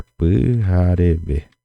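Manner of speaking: pijarebe said slowly, each syllable clear; Guarani word pijarebe. Shown as a demonstration. Teacher modelling pijarebe slowly for students. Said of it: "decir mañana en el presente".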